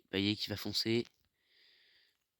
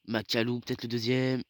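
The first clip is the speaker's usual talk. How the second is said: excited sports commentary; 3 semitones higher, 7 dB louder than usual. Real, not acted. Chance for a second goal.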